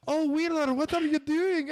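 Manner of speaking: high-pitched cartoon Russian accent; Linka. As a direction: do a hammy Russian spy-girl accent. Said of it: "Did Natasha from Rocky and Bullwinkle".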